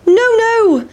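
very high squeal of refusal; high, playful storybook protest; defiant pancake. Acted.

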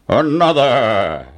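slow, gruff giant command; giant's order. A narrator's reading. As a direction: drag out the demand like a greedy giant. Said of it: "ordered the giant".

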